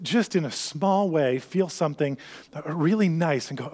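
usual speaking voice

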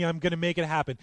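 preacher voicing Jesus' oath with full force; fervent vow. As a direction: swear it to the end.

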